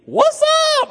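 very high, loud, comic whoop; hammed-up shout. Coworker offering a slang reply to thank you, comic shout. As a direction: holler it as a joke greeting.

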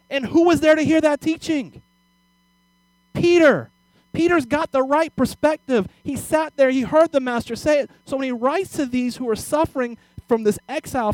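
high, loud preacher in full flow; fiery preaching. Acted. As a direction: preach it with fire.

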